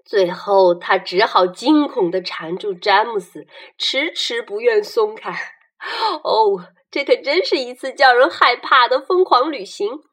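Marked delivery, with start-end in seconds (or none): none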